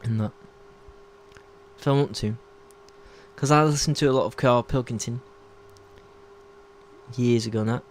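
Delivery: low, softened Manchester accent. Shown as a demonstration. Showing off an accent learned from Carl Pilkington, low Manchester voice. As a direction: put on a casual Manchester voice. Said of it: "I can do kind of a, a Manchester one".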